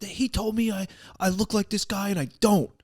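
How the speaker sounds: high-pitched whiny protest, imitated; mock meltdown. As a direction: mimic a kid throwing a tantrum. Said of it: "having a fucking full-blown meltdown".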